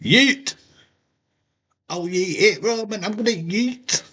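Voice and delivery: high, excited mock chant of yeet; excited yeet cry. Crowd chanting at Roman, high and excited. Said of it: "main event's going".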